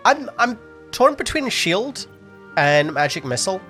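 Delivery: ordinary talk, nothing marked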